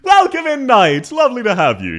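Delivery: very high, loud greeting; called-out welcome. Real, not acted. Streamer welcoming a viewer by name, high loud call-out. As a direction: sing out a high, excited hello.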